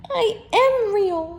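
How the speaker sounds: high, loud desperate insisting; near tears. Acted. Toy rabbit insisting to the wild rabbit, near tears. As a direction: plead close to crying. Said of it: "said the little rabbit".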